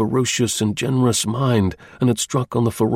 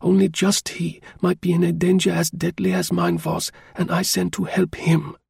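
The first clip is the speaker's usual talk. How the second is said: higher, lightly voiced woman pleading her case; a woman recalling a friend in danger, earnest; woman telling him she would help; woman's voice; 8 semitones higher than usual. A narrator's reading. Woman speaking in dialogue.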